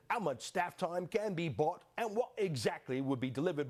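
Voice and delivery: how much staff time in a mock British official voice; prim, put-on British read of the question; British accent. Host reading the British letter, mock British accent. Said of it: "Forget my accent".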